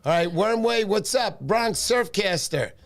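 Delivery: ordinary delivery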